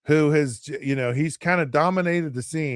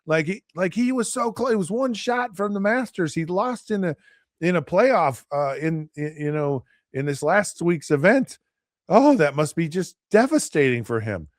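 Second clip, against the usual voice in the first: higher, whiny fake pity for the golfer; sarcastic, higher mock-sympathetic whine; mock sympathy; 5 semitones higher than usual. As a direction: imitate fans pretending to be heartbroken. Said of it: "'cause I know you all are just like".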